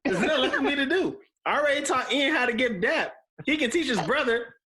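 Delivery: high, fast, laughing joy; laughing excitedly. Real, not acted. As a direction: gush through laughter. Jokes he can die happy.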